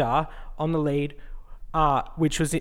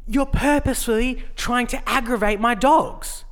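high, slowish mock accusing tone; mimicking her accusation; 7 semitones higher, 3 dB louder than usual. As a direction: put on her prickly accusing voice. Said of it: "then she was like".

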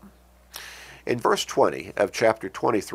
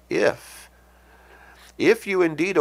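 "If" set apart high, then repeated after a long pause; stress on IF; 9 semitones higher than usual. Real, not acted. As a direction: isolate the word "If" and let it hang. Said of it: "I emphasize that word".

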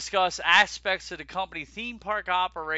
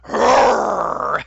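roar; 2 semitones higher, 11 dB louder than usual. Pretend. Imitating animal at night.

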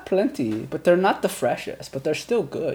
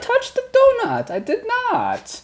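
high, louder, insistent denial; indignant denial; 6 dB louder than usual. Retold or imitated. [name] denying eating the donut, high insistent squeak. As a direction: squeak out your innocence. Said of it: "And I said".

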